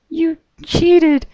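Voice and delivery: very high pony voice, a touch breathless; gasped Pinkie. A narrator's reading. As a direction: push it out with dying breath. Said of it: "She gasped out".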